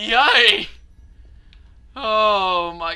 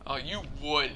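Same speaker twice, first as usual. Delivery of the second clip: usual speaking voice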